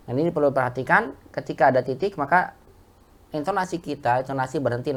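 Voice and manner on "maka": normal talking voice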